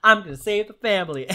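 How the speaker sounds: hammed-up player pledging to save the family; mock heroic vow. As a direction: rattle it off like a gung-ho hero. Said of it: "You're like".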